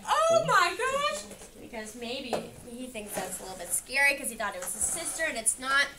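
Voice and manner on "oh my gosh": high, loud, drawn-out "oh my gosh"; mock scream. Reader voicing Max's scared scream, high and loud. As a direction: squeal it like a startled little boy. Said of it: "And Max screamed".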